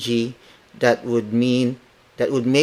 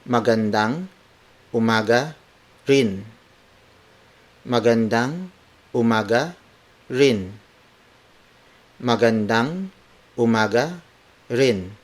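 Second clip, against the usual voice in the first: each word set apart by a pause. Shown as a demonstration. Teacher breaking the reply down for learners, word for word. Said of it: "Word for word".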